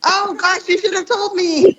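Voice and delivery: high-pitched, laughing protest; real giggly embarrassment, voice shooting up; embarrassed laughing. Real, not acted. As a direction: squeal it through real laughter. Embarrassed laughter at camera framing.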